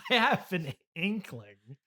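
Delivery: ordinary talk, nothing marked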